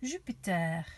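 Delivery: "Jupiter" in French, zhü-pee-TAIR; French accent. Shown as a demonstration. Teacher showing children how to say "Jupiter" with a French accent. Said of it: "bit of a French accent".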